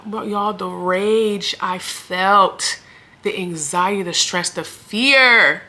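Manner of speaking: each word stretched out slowly; slow emphasis. Real, not acted. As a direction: drag out every feeling. Reliving rage at hospital.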